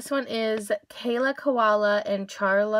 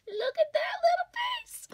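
very high-pitched cooing; adoring squeal. Real, not acted. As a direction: squeak it, overcome by cuteness. Cooing at the cow's face.

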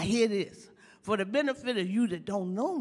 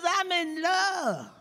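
high, slow mock sobbing girl; hammed-up weeping lovesick girl; crying girl; 10 semitones higher, 6 dB louder, much slower than usual. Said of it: "She says".

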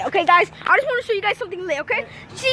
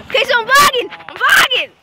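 loud, higher, giddy shouting; hyped vlogger; 6 semitones higher, 6 dB louder than usual. Real, not acted. Kid vlogger hyping himself up for viewers, loud and giddy. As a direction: get carried away with the camera. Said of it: "this is how I get when I'm vlogging".

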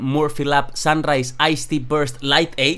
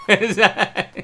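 laughing; 7 semitones higher, much slower than usual. Real, not acted. Laughing at own joke.

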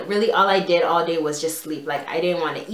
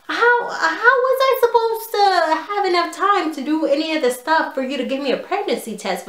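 much higher, incredulous protest; exasperated disbelief; 8 semitones higher than usual. Retold or imitated. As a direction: squeak it out in outrage. Teen protests the pregnancy test.

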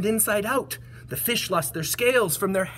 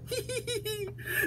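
soft, high tee-hee giggle; acted giggle; 10 dB quieter than usual. Elephant begins to giggle, soft high titter. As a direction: titter quietly in a high pitch. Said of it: "He began to giggle".

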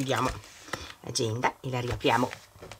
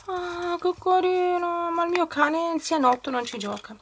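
cute cooing voice. Real, not acted. Cooing over a pet toy.

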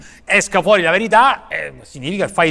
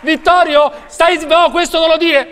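high, loud shouting to reel a guest in; mock host yelling; 9 semitones higher, 6 dB louder than usual. Talk show host shouting at guest Vittorio to stop, high loud yell.

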